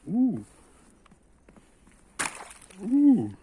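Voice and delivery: very high, loud whoop; excited whoop. Real, not acted. Gamer whooping with fun, excited. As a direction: whoop with delight.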